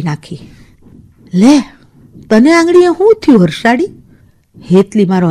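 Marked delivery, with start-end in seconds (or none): 1.3-3.9 sudden high worried question; startled friend asking what happened to her finger; surprised. Acted. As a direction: ask in alarm, voice jumping up.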